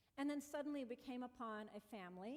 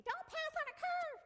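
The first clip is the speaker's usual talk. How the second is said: high, loud scolding yell; yelling sister; 8 dB louder than usual. Retold or imitated. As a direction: shout a warning at her sister. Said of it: "my sister's yelling at me".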